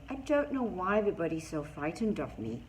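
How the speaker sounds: plain reading voice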